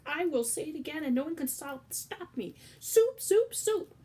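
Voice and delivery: loud, high repeated shouts; defiant shouting. Acted. Cook defying the ban, loud shouting. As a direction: hurl the word again and again.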